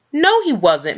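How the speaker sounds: a firm little no; insisting. Acted. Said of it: "Mary insisted".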